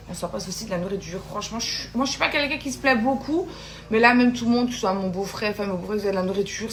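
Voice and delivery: normal talking voice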